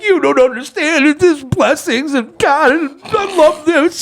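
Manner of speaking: high, loud, drawn-out sobbing; acted bawling. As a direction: imitate blubbering so hard you can barely talk. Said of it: "I'm bawling, man. I can't even talk right".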